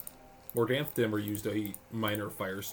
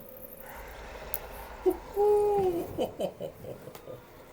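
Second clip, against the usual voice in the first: evil laugh. Pretend. Villain laugh after power boast.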